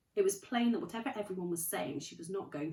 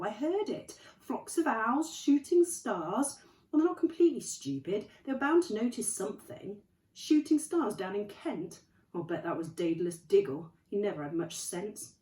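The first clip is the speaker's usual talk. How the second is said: stern McGonagall; 5 semitones higher, 8 dB louder than usual. Acted. McGonagall voiced, scornful of Muggles.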